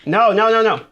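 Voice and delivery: high, loud "no no no" as the boss closes in; panicked shout. Real, not acted. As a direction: yell in real alarm. Boss nearly kills him.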